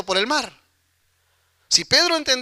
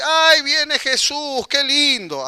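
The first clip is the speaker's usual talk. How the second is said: mock delight; 6 semitones higher, much slower than usual. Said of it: "Así exclamaron".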